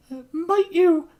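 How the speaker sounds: high falsetto woman's voice; female voice. Acted. Noblewoman reassuring the shopkeeper, high falsetto. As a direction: lift it into a lady's falsetto. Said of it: "the most consistent quote-unquote female voice".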